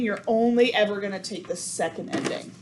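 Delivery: ordinary talk, nothing marked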